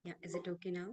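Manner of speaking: very faint, distant mic voice; barely audible. Real, not acted. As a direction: speak through a mic set far too low. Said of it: "it's, it's low".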